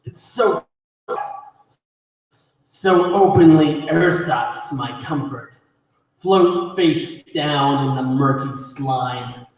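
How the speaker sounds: low, grim poem reading with pauses; poetry recitation. Acted. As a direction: recite it low and measured, line by line. Performs own poem.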